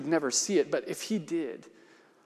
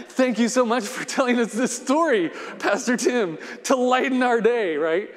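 laughing; 7 semitones higher, 5 dB louder than usual. Real, not acted. Jokes about the grim ending.

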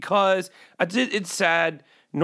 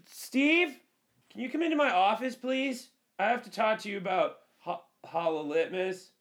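high, softer mock boss voice; boss calling in; 5 semitones higher, 7 dB quieter than usual. Boss calling Steve in over the bad name, high soft mock voice. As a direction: summon Steve like a prim manager.

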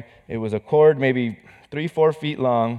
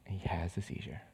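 hushed whisper, dropping low; whispered reveal; 7 semitones lower, 19 dB quieter than usual. Real, not acted. Pastor whispering the moment his son seized. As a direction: breathe it out like a secret.

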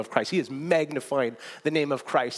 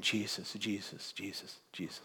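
much softer, lower, fading away; quiet fading repetition of Jesus; softly repeated name; 6 semitones lower, 13 dB quieter than usual. Retold or imitated. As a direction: let it fall to a hush. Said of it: "in his own way is standing on a stage saying".

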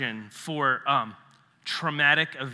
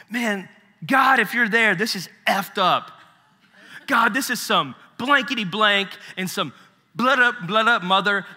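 loud, higher, raw plea to God; raw desperate prayer; 6 semitones higher, 6 dB louder than usual. Retold or imitated. Big friend Miles praying his heart out, loud and raw. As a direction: yell it at God in frustration. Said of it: "And Miles is like".

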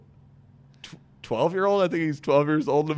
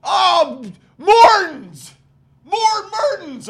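high, loud hammed-up yell; mock coach yelling; 9 dB louder, much slower than usual. Coach calling a kid from the dugout, loud mock yell. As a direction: yell the kid's name across the field.

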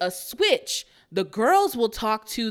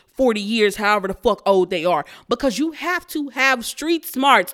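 normal talking voice

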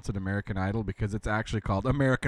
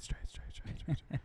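fading, whispered "Strait" repeats; mock echo; 4 semitones higher, 15 dB quieter than usual. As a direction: make "Strait" echo off into the distance. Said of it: "it's almost like an echo in here".

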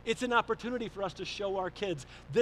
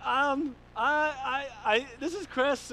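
higher, louder, halting mock distress; imitated tearful caller; 7 semitones higher, 6 dB louder, slower than usual. Troubled pastor phoning dad, imitated tearful stammer. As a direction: stammer it out as if upset. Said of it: "he's, he's crying".

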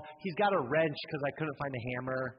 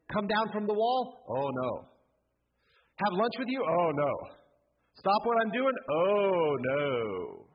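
louder, punchy "Oh, no" each time; mocking refusals; 4 semitones higher, 4 dB louder than usual. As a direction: scoff at every offer with a loud no. Nehemiah's mock replies to invitations.

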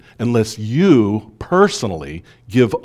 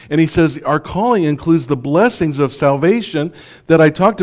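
higher and louder preaching at full force; rousing preaching; 6 semitones higher, 4 dB louder than usual. Acted. Pastor preaching the calling to the congregation, rousing and raised.

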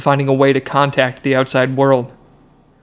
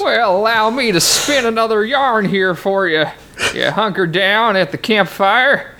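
put-on old prospector; 7 semitones higher than usual. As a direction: spin it like a crusty old prospector. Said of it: "The old prospector".

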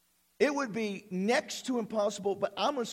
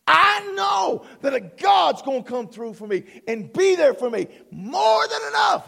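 fast, loud, rising preacher's build; preaching at full force; 4 semitones higher, 5 dB louder than usual. Real, not acted. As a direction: build the sermon to a shouting, near-crying peak.

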